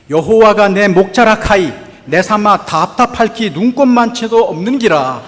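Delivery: loud, broad Gyeongsang dialect reading. Shown as a demonstration. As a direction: read it like a proud Gyeongsang man. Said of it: "먼저 경상도 버전입니다". (큰 소리로 읽는 경상도 사투리)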